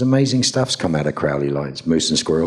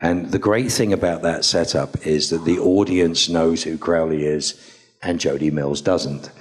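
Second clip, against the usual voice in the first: normal talking voice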